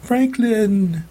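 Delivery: high, drawn-out desperate call; crying out. A narrator's reading. Mom crying to her husband while dragged out. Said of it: "she cried".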